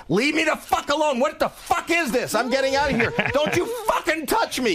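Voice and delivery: high, frantic panicked shouts; panicked yelling. Retold or imitated. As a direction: scream at them to back off in terror. Guy panics at dancing gang.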